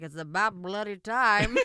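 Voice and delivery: a posh Brit, badly faked; terrible British accent. Quoting the British officer, terrible British accent. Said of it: "I did that accent terrible".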